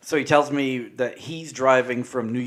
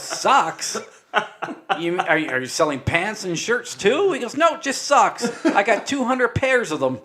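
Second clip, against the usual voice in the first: quoting while laughing, pitched up; can barely get the quote out for laughing; giggly retelling, louder; laughing through quote; 9 semitones higher, 5 dB louder than usual. Retold or imitated. Retelling border guard, cracking up.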